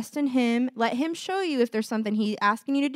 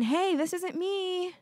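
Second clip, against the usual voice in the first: higher, slow bright declaration; declaring identity; 7 semitones higher, 3 dB quieter, slower than usual. Retold or imitated. Believers rejecting old thoughts, slow lifted declaration. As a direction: say it like a fresh realization. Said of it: "we just started saying".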